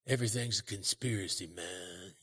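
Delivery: normal talking voice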